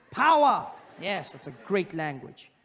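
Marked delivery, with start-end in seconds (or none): 0.1-0.7 high, loud punched word; loud high barked word; power punch. Pretend. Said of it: "everything in Japanese is strong".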